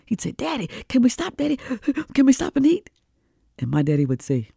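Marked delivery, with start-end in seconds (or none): 0.3-2.9 put-on high pleading kid voice; begging little boy. Hungry boy begging his daddy to stop, high and pleading.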